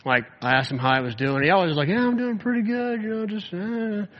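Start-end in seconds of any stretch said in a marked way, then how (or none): 1.9-4.1 high-pitched, noncommittal brush-off; imitating a player fobbing off his coach; evasive. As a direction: dodge the question in a higher, drawn-up voice. Acted evasive answer to coach's check-in.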